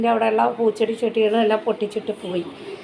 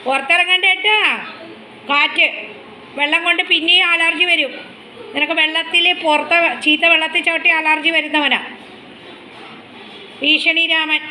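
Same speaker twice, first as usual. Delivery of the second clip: put-on high, sing-song, louder baby talk; high pet-talk voice; 6 semitones higher, 4 dB louder than usual. Owner playfully scolds her dog in high baby talk. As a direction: tease the dog like a small child.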